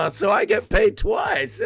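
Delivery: high, slow, hammed-up greedy voice; mock gloating. Streamer pretending greed at being paid twice, high mock gloating. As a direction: savor it slowly up high, like a pantomime villain.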